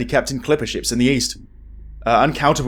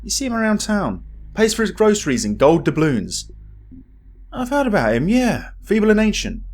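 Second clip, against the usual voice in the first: high-pitched chatty local gossip; lightly raised gossip voice; gossiping townsman; 8 semitones higher than usual. Acted. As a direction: play a nosy old villager passing on rumours.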